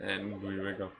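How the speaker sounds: ordinary delivery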